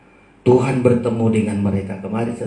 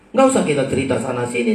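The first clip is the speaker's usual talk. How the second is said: ordinary delivery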